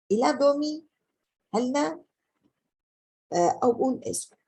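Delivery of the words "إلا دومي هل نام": دومي said high and rising, as a question; question intonation. Shown as a demonstration. Teacher gives a second example of a question asked by tone. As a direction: ask it with the voice held up high. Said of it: "بعطيه نبرة عالية".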